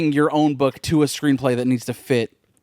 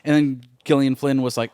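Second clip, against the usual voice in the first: usual speaking voice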